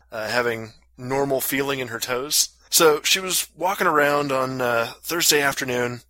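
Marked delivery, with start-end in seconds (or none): none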